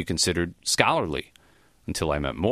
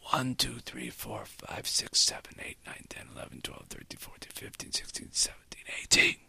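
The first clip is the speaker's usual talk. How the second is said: breathless whispered counting, fading out; a dying man counting in a breathy whisper; soft, wobbly count; 9 dB quieter than usual. A narrator's reading. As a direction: count on a failing breath, running out of air. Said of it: "began his number count in a soft, wobbly voice".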